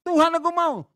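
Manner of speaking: high, loud whiny demand to God; stubborn insistence. Retold or imitated. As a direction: insist like a spoiled child begging. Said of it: "Kamu ngotot".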